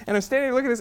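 ordinary talk, nothing marked

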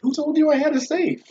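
high, incredulous squeak; mock disbelief. Answering the robber, high mock disbelief. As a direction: act baffled that anyone thinks he has a safe. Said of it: "I'm like".

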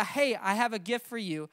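usual speaking voice

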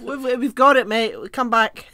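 loud, high mock shout; playful hollering; calling out. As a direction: call after someone far away. Calling the explorer back, acted.

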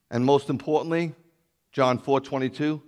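ordinary delivery

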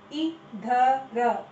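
इधर drawn out slowly as इ-ध-र; syllable by syllable. Shown as a demonstration. Teacher sounding out इधर for children. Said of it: "सही उच्चारण करो".

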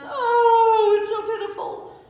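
very high, very loud wail; hammed-up pitiful howl; mock wail. Teacher pitying the crying baby llama, hammed-up wail.

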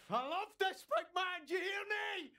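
wailing shout of loss; anguished shout. Acted. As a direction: bellow your love in grief. Grief shout over body.

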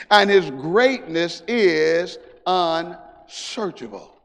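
loud, slow preaching cadence. Acted. Preacher praising God before the congregation, slow and loud. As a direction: stretch out each phrase.

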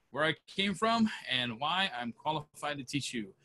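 normal talking voice

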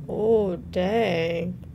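usual speaking voice

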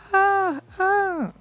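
very high mock squeal of disgust; mock shriek. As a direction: squeal like the girl again.

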